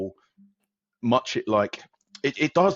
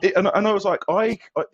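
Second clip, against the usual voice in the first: excited stammer; 11 semitones higher, 6 dB louder than usual. Real, not acted. Stammering, laughing disbelief.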